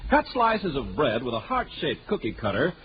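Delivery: ordinary talk, nothing marked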